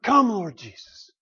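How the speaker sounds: earnest, heartfelt call; sincere, longing invitation; sincere plea. Shown as a demonstration. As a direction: say it as if you truly mean it. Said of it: "say with a sincere heart".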